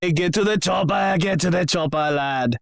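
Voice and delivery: high, pushed Austrian action-hero bark, fading at the end; Schwarzenegger impression. Dwarf mocks the comparison with a hammed-up Schwarzenegger accent. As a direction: do a hammed-up Arnold yelling to get to the chopper. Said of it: "like a very awkward Schwarzenegger".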